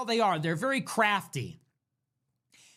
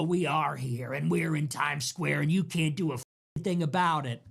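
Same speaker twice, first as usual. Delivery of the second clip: much lower, taunting villain voice; low menacing mock; 7 semitones lower than usual. Mocking the Muslims as low, gloating taunters. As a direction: growl it like a smug bully. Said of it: "And they go".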